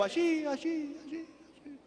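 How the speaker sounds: high, soft repeats dying away; mimicked echo, each repeat fainter; fading echo. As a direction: imitate an echo vanishing down a ravine. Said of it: "y se escuchaba el eco".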